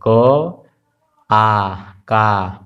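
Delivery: sounding out क then आ then का slowly; slow syllable demo. Shown as a demonstration. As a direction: break the syllable into its parts, drawn out. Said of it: "तो उसमें आ जरूर आएगा". (धीरे-धीरे अक्षर जोड़कर उच्चारण)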